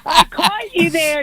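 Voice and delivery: teasing with a laugh; gotcha, laughing. Real, not acted.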